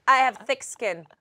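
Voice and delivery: ordinary talk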